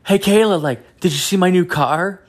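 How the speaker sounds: imitating an eager show-off; excited doctor. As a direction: call out proudly, pitched well up. Voicing doctor calling to Kayla.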